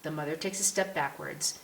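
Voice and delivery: ordinary delivery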